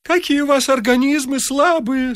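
lightly voiced sugary old man; sweetly. A narrator's reading. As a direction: purr it sweetly. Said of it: "сладко сказал Варфоломеич".

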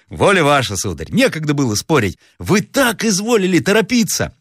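high-pitched servant making excuses; оправдывающийся слуга. Acted. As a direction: plead the case to the master in a high voice. Servant answers master's rebuke. (слуга оправдывается высоким голосом)

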